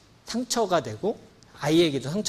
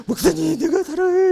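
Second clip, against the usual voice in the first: high, loud mock church member; imitating a caller; 9 dB louder than usual. Church member gushing to the pastor on the phone, high mock voice.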